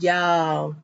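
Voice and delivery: ordinary talk, nothing marked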